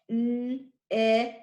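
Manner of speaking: "n, ick" split into first sound and ending. Shown as a demonstration. Teacher breaks down picnic for first graders, sounding out. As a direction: hold the n, pause, then say "ick". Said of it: "picnic has the ick".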